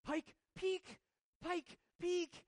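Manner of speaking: put-on high, squealing little-girl voice; excited toddler. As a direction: imitate a two-year-old thrilled to spot the mountain. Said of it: "And she goes".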